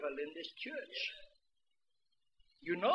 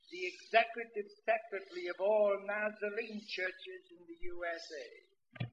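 ordinary talk

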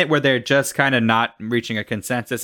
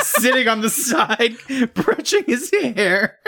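laughing; 8 semitones higher, slower than usual. Pretend. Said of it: "It's like Rapunzel".

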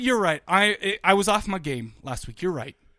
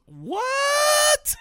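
a mock high-pitched yelp of a wife, much higher; shrill wife what. Wife calling up from the stairs, mock high yelp. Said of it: "my wife comes up and she goes".

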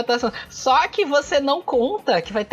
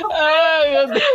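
laughing; 4 semitones higher, 3 dB louder, much slower than usual. Real, not acted. Cracking up at joke.

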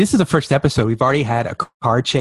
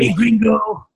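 higher put-on tough-guy greeting; mock bar thug; 9 semitones higher, slower than usual. Bar thug greeting the hero, hammed-up menace. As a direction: sneer it like a movie heavy.

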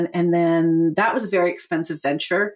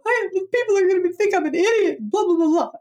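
high panicked inner voice; 12 semitones higher than usual. Retold or imitated. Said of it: "I cry for the first fifteen minutes".

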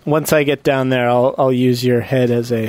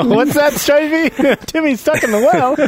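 high mock kid voice; 13 semitones higher than usual. Lassie joke, high put-on voice.

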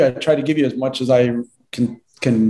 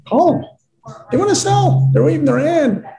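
much higher and louder, insistent; emphatic urging; 13 semitones higher, 3 dB louder than usual. Real, not acted. Coach urging agents to call sellers, loud high insistence. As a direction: push it hard, almost exasperated.

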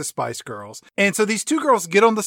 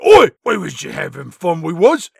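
louder put-on cockney girl; 2 semitones lower, 5 dB louder than usual. Spice Girls to [name], mock cockney shrug. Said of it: "the Spice Girls are like".

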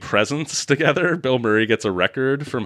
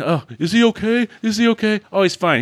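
much higher mock worried voice; hammed-up medic; 10 semitones higher than usual. Mocking the surgeon scene, high hammed worry. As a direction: overplay the anxious medic.

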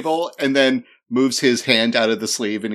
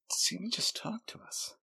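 whispering; 7 semitones higher, 18 dB quieter than usual. Acted. Amazed whisper to friends.

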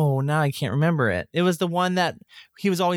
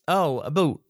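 "boot" in place of "about", Canadian style; mock Canadian accent. Shown as a demonstration. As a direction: try out a joke Canadian "a boot". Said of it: "I think it's like a boot".

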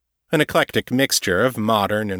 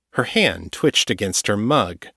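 ordinary delivery, nothing marked

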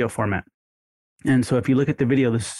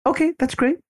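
upbeat caller. Shown as a demonstration. Caller upbeat phone line.

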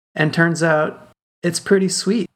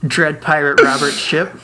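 grandpa voice; 3 semitones higher, 4 dB louder than usual. Acted.

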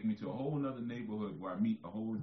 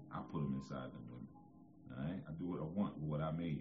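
ordinary delivery

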